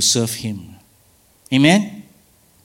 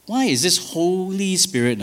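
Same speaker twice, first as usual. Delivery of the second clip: ordinary delivery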